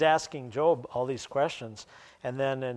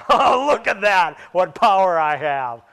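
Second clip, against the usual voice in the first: very loud, hammed-up self-amazement; mock boasting; 2 semitones higher, 11 dB louder than usual. Sunrise watcher claiming credit for dawn, mock boastful shout. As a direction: marvel loudly at your own pretend power.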